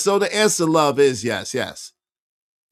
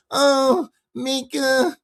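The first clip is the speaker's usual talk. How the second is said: mocking coo; 6 semitones higher, much slower than usual. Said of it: "does not talk like".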